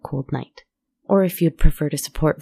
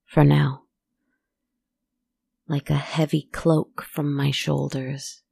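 haunting first-person storytelling; eerie narration. A narrator's reading. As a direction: tell it like a ghost story by the fire. First-person horror monologue over music.

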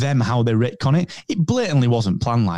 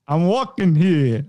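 slow, higher New York yell; New York accent; 4 semitones higher, slower than usual. Retold or imitated. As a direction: Walken doing a New York cabbie.

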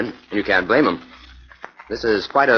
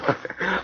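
amused laugh; 11 semitones higher, 5 dB quieter than usual. Acted. Jim laughs at Fern's joke.

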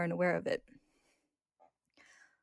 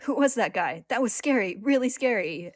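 louder, higher mock-frightened whining; scared complaint; 4 semitones higher, 8 dB louder than usual. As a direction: act shaken after a creepy night. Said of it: "Ed and Al complain".

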